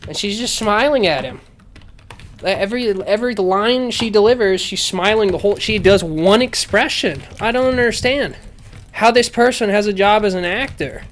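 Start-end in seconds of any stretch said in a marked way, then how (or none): 2.4-11.0 higher, baffled exasperation; exasperated rant. Real, not acted. Critic on an actress who can't act, exasperated rant. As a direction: rant in disbelief, pitch riding high. Ranting about an actress's one expression.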